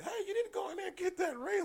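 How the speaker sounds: high, fast urgent order; high-pitched retold command; imitated bossy nurse. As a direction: do a nurse squawking an instruction.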